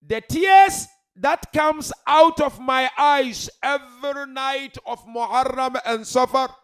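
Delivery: slow, high, thunderous sermon shout; full-force preaching. Real, not acted. Preacher declaring the aim of his tears, slow full-force shout. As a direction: proclaim it loudly, drawing out the words.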